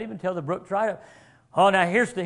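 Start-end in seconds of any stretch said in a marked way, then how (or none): none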